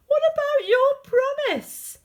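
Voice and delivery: high, loud, hurt frog; indignant shout. Acted. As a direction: call out the broken promise. Frog calls after princess.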